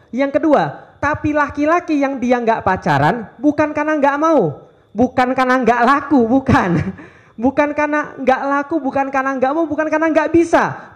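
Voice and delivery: pitched high, each bukan hammered in turn; rising emphatic list. Real, not acted. Preacher insists to the youth why men avoid dating, high and emphatic. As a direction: build the list up like a rallying cry. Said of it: "preacher builds a rising list".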